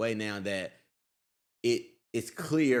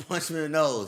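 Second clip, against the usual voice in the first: normal talking voice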